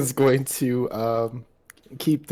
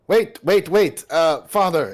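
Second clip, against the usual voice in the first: higher, louder, hurried pleas; urgent calling out; 5 semitones higher, 5 dB louder than usual. Acted. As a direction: stop him before he drinks. Son rushing to stop father.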